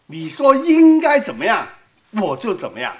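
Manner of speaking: ordinary talk, nothing marked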